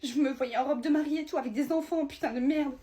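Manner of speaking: high-pitched heartbroken lament; tearful. Acted. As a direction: wail over the life you imagined. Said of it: "pleure pas, tu t'en fous". (lamentation en pleurs, cœur brisé)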